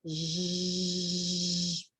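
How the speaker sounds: sustained buzzing "zhhh", held low and soft; long "zh" sound. Shown as a demonstration. Teacher showing learners the sound of letter G, sustained "zh". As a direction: hold the soft G as one long buzz. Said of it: "ela pode ser assim".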